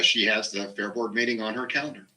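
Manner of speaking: ordinary talk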